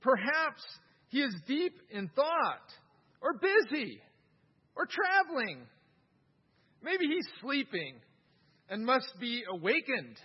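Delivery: a mock-sweet taunt of a sleepy idol. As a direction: tease them about their sleeping god. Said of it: "Elijah began to taunt them".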